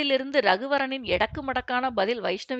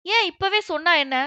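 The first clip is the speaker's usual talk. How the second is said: higher, louder demand; exasperated demand; 6 semitones higher, 5 dB louder than usual. A narrator's reading. Employee demands an answer from the boss, exasperated. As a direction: push back with rising exasperation.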